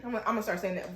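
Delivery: ordinary delivery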